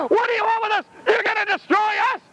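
high, frantic outcry; high-pitched wail of protest; crying out. Acted. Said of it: "if you cry out".

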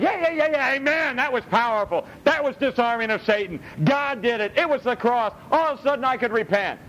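mock complacent churchgoer. Preacher mocks a complacent churchgoer, breezy and high. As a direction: lightly play the self-satisfied listener. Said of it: "And it's like".

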